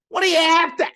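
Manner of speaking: loud, high broad Aussie demand; broad Australian accent. Retold or imitated. God asks you each morning, booming Australian bogan accent. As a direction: bellow the question as an Australian bogan. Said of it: "in this very broad Australian accent".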